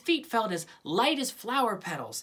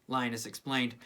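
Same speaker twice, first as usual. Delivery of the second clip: plain reading voice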